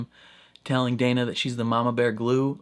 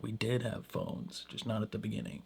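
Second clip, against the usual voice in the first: sarcastic mutter; 10 dB quieter than usual. Retold or imitated. Doctor muttering after she leaves, soft and sarcastic. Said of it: "that little sarcastic response".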